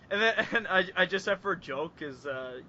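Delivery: ordinary delivery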